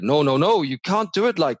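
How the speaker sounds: put-on terrible French accent. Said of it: "That's terrible French".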